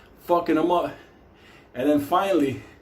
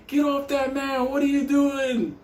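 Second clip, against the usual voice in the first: mock crowd scream; 9 semitones higher than usual. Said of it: "People screaming".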